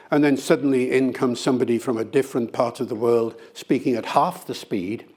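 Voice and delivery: ordinary talk, nothing marked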